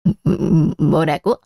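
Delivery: mother hurt to tears by her daughter-in-law; choked-up disbelief. Acted. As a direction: swallow a sob and ask in shock. Mother hurt by daughter-in-law's words.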